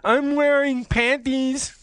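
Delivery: very high, slow put-on voice; hammed-up squeaky flirtation; game master role. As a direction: draw it out in mock falsetto. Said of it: "I could be the G M".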